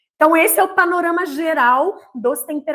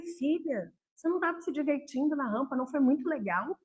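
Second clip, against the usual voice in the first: much softer, tender coaxing; gentle mother; 9 dB quieter than usual. Retold or imitated. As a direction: soothe the daughter softly. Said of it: "Eu falei".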